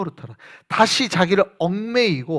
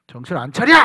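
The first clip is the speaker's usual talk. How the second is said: drill shout; 3 semitones lower, 5 dB louder than usual. Retold or imitated. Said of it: "막 이러고".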